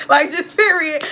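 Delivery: high, loud laughing line. Real, not acted. Laughing at her exit from grandma's, laughing. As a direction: giggle it out.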